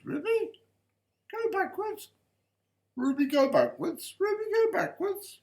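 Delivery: high, sing-song go backwards; teasing high voice. Pretend. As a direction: tease him in a high voice.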